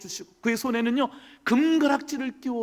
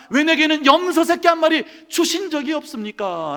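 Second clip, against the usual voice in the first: louder, higher resentful demand; a grumbling son confronting his father; sulky protest; 6 semitones higher, 7 dB louder than usual. Acted. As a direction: complain bitterly, demanding an answer. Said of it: "라고 볼멘 소리로 따졌습니다".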